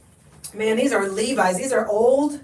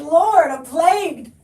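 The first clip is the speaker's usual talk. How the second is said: mock lament; 5 semitones higher than usual. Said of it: "I said".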